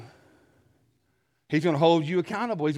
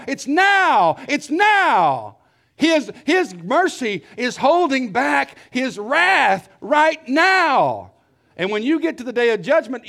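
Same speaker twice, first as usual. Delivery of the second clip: loud, rising pulpit preaching; preaching at full force; 9 semitones higher, 7 dB louder than usual. Acted. Preacher warning listeners there is no escape, full force. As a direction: build it up and hammer the warning.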